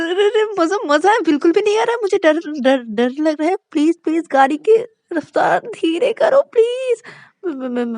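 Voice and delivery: high, loud stammering panic; terrified shriek. Acted. Shruti shrieks at Shreya to stop speeding, terrified. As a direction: shriek at the speeding driver in terror. Terrified passenger screams for mummy.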